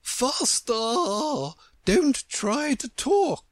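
high-pitched, drawn-out Queen's cry; urgent cry. A narrator's reading. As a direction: urge on imperiously in a high voice. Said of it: "for she cried".

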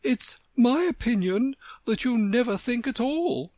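high, emphatic reproof; rather severe. A narrator's reading. The Rose rebuking Alice, rather severe. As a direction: scold from a high, haughty pitch. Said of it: "in a rather severe tone".

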